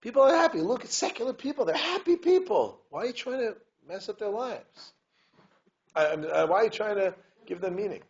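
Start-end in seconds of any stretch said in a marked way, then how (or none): 0.0-4.9 high, loud, incredulous questioner; skeptic's challenge. Retold or imitated. A hint of a skeptic challenging the rabbi. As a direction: push back like a doubting student. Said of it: "this is what somebody asked the rabbi".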